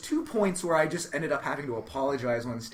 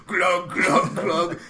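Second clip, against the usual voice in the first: gulping noise; 3 semitones higher, 8 dB louder, much slower than usual. Retold or imitated. Imitating chugging whiskey.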